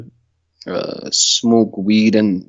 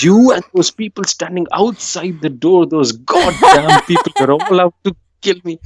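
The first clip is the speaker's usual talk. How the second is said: higher, slow, laughing paranoid rant; a stoned friend accuses her girlfriend, slow, high and half-laughing; paranoid accusation; 5 semitones higher than usual. Retold or imitated. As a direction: drag it out like a terrified stoner. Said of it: "I'm like".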